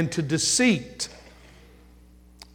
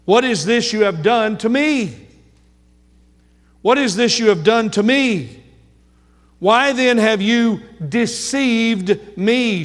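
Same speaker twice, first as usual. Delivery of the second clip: Jacob's anguished plea; 4 semitones higher, 3 dB louder than usual. Acted. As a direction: plead the betrayal like a wronged husband. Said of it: "Now he speaks these words".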